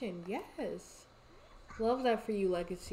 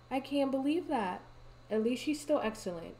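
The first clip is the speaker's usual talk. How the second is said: ordinary talk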